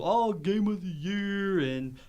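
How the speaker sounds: high, loud, drawn-out mockery; mock grand announcement. Host mocking everybody else's award shows, mock grand. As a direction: stretch it out like a pompous award title.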